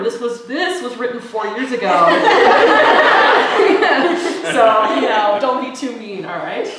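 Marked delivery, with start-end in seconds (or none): 2.0-4.4 loud embarrassed laugh; nervous laugh. Real, not acted. Nervous laugh before poem.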